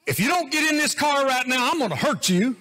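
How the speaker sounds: high, loud mock threat at the kids; Donna's mock threat. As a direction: ham up an angry mother in the car. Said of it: "Donna would say".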